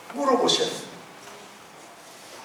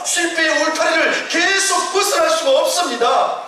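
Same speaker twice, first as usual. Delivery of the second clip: raised, fervent sermon voice; rising pulpit fervour; preaching at full force; 10 semitones higher, 7 dB louder than usual. Acted. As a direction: preach it up high and strong. Preacher's climax.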